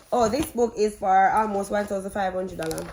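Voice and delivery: ordinary talk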